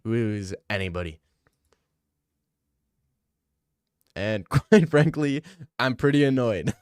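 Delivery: hammed-up pompous reporter, half-laughing; a hint of an annoyed reporter voice; Brett Okamoto impression. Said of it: "What does Brett Okamoto sound like?".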